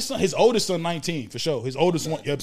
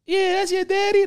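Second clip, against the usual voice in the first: much higher mock-sweet mother voice; mimicking the mom; 3 dB louder than usual. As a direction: chirp it like a fake-cheery mom. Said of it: "then she's like".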